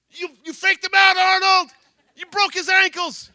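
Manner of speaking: loud, high cheering shout; cheering crowd. Retold or imitated. Students cheering for Arnold, loud high shouting. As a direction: roar it like students on their feet.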